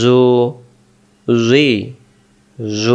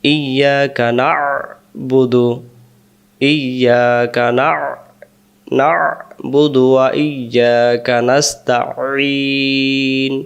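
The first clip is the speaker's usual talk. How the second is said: slow recitation; much slower than usual. Shown as a demonstration. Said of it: "আইন অক্ষরগুলো আমাদের খেয়াল করে উচ্চারণ".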